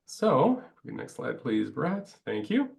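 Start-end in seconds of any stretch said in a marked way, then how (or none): none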